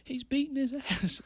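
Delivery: high-pitched imitation of an excited sister. Fighter imitating his sister as she runs out, high and excited. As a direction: mimic your sister cheering, pitch way up with a laugh. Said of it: "my sister runs out".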